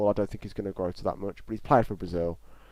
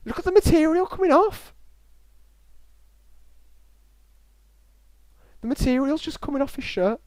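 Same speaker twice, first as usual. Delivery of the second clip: high, loud, incredulous exclamations; real astonishment; 8 dB louder than usual. Real, not acted. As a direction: cry out high and loud in disbelief. Astonished at shirt material flaking off.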